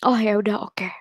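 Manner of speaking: whispered resigned acceptance. Retold or imitated. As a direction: say it under the breath. Giving in to the brother. (berbisik pasrah)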